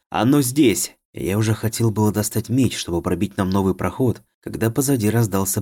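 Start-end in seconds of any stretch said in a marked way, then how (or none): 0.1-1.0 louder, higher glad shout; joyful exclamation. A narrator's reading. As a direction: cry it out in joy. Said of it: "радостно воскликнул".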